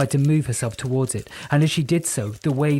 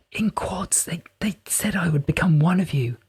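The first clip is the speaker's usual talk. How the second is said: whispering Pyrope; 5 semitones higher than usual. A narrator's reading. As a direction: breathe each word like a hidden voice.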